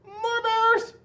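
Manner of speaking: put-on high, loud kids' yell; high-pitched childlike holler; children yelling. A narrator's reading. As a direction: yell it like excited boys and girls. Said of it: "the boys and girls yelled".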